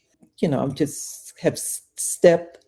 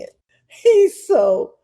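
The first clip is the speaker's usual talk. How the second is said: ordinary delivery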